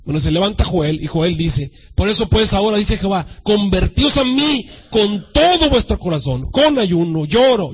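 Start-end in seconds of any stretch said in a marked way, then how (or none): 1.9-7.7 slow, emphatic Bible reading, key words stretched; declaimed scripture reading. Acted. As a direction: proclaim the verse with weight. Reads scripture aloud with force.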